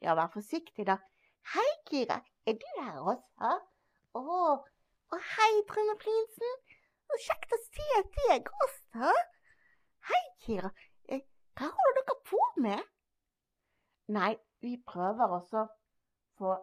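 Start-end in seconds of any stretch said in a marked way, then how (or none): 1.5-10.7 high, delighted little dragon voice; cheerful dragon puppet. Acted. Dragon puppet greets them. 11.1-12.8 high, delighted little dragon voice; cheerful dragon puppet. Acted.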